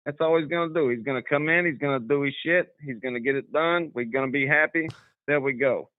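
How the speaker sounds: put-on amateur New York accent with a Southern twang creeping in. Southern caller attempts a skit for the radio host, New York accent. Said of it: "do my New York skit".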